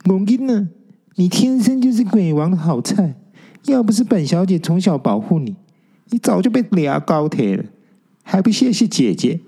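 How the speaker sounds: high, bossy big-sister warning; mock warning, high ghost girl. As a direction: wag a finger and lecture him, high. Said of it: "还一边警告着说". (高声摆姐姐架子警告)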